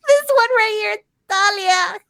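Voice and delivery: high-pitched, laughing cry of self-blame; mock self-callout. Host owning up to the editing slip, mock accusing cry. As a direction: call yourself out, voice shooting up high. Mock calling herself out for mistake.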